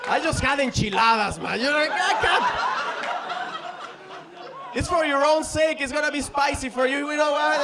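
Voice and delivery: high, loud pleading; hammed-up desperate protest; panicked excuses. Comedian warns the girl about his dinner, panicked and pleading.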